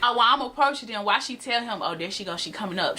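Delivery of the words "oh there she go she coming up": hushed, softer imitation of a warning; mock whisper, still voiced; imitated whisper. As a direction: lower your volume like a sneaky heads-up. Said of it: "She try to whisper it".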